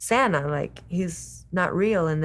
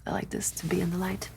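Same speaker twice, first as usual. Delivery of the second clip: quick unvoiced whisper; 9 dB quieter, faster than usual. Real, not acted. Wife whispering an aside during the sign-off. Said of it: "whispering aside".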